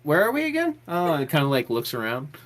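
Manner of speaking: a hint of a dreamy mapmaker; distracted Aaron. Aaron looks up from her map-making, high and distracted.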